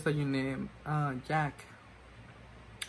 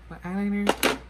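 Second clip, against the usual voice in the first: louder burst on eyeliner; loud exclaim; 5 semitones higher, 9 dB louder than usual. Real, not acted. Vlogger exclaims over her ruined eyeliner, loud. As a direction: blurt out the last word.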